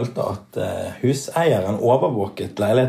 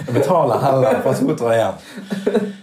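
laughing; slower than usual. Retold or imitated. Drunk self's spontaneous decision.

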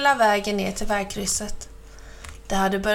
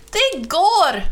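high, loud and thrilled; excited cheer; 8 semitones higher, 6 dB louder than usual. Acted. Mamma Mu cheering as she finally rides off. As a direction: burst out with joy at last.

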